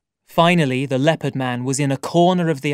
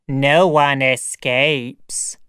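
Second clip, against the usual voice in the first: very slow, drawn-out monster pronouncement; heavy, stretched-out creature voice; sayer of the law; much slower than usual. Acted. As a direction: intone it slowly like a grim law. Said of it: "said the sayer of the law".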